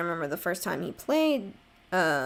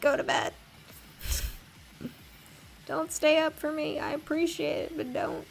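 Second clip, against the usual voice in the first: higher, fond mock scold; playful higher nagging; motherly scolding; 10 semitones higher than usual. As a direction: shoo them to bed kindly. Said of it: "and I was like".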